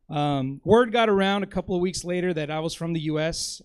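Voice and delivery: ordinary delivery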